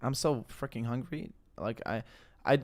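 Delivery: ordinary delivery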